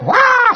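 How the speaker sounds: high playful yelp; mock yelp. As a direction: squeal like a startled shopper. Mock cry of healed person.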